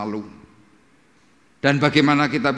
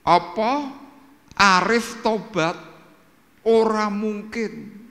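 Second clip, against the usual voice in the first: high, drawn-out cry of disbelief; acted shout, pitched way up; imitated disbelieving shout; 11 semitones higher, 3 dB louder, much slower than usual. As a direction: mimic people crying out, stretched and incredulous. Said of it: "orang langsung teriak begini".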